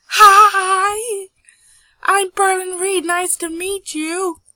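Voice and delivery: very loud, high-pitched introduction; overeager greeting. Acted. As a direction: blurt the greeting out at full volume. Perlin's overeager reply to girl.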